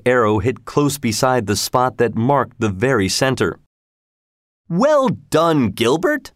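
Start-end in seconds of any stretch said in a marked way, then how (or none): 4.7-6.3 cried out praise. Acted. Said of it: "cried the sheriff".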